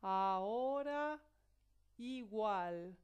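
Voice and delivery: slow, loud, careful "ahora" and "igual"; example words. Shown as a demonstration. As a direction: spell out "ahora" and "igual" clearly. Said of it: "una vocal".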